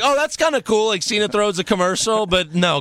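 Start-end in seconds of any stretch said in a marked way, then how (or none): 0.0-0.8 high impressed voice. Retold or imitated. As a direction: say it pleasantly surprised. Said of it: "I was like".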